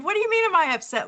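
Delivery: high, rapid, taken-aback comeback; retold baffled protest, rushed and high-pitched; incredulous retort. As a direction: fire it back fast and high, in mock disbelief. Reacting to brother's misread text.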